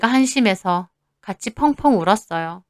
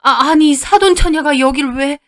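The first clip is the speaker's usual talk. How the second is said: higher, louder flustered stammer; startled; 5 semitones higher, 4 dB louder than usual. Acted. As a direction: caught off guard by the in-law. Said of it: "놀라서 쳐다봤습니다". (깜짝 놀라 당황한 말투)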